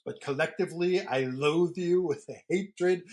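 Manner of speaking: theatrical mock-villain declaration; mock hateful vow. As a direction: spit out the hatred like a stage villain. Retold play speech, mock vow.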